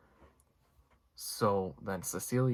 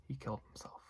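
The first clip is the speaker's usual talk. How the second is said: soft unvoiced whisper, much softer; 4 semitones higher, 10 dB quieter, faster than usual. James backing up Cecilia's lie, whispered. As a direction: hush it like a shared secret. James agrees to cover murder.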